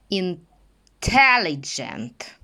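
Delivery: "Intelligent" drawn out syllable by syllable, hard dzh; very slow model word. Shown as a demonstration. Teacher slows the word down for students. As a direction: spell out "Intelligent" very slowly. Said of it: "твердий дж".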